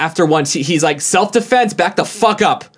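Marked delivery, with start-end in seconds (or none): 1.0-2.6 retold yell. Employee warning off a shoving shoplifter, mock yell. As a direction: imitate his yelled warning without real volume. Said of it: "and he yelled, like, at the top".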